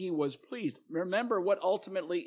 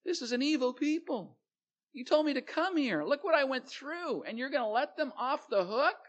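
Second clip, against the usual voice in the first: higher, indignant complaining voice; Jonah's inner grumbling; 8 semitones higher than usual. Retold or imitated. Preacher voices Jonah's private thoughts to God, indignant. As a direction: whine it like the wronged prophet who wants them punished. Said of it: "you know he's thinking another".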